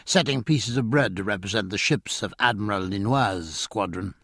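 usual speaking voice

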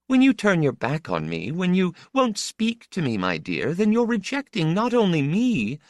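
high-pitched, impassioned motherly voice; exultant mother. A narrator's reading. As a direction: plead with your son, pitched well up. Mother's long speech to her son.

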